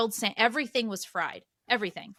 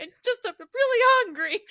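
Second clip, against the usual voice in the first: laughing through tears; 14 semitones higher, 5 dB louder than usual. Real, not acted. Teary retelling, laughing at herself.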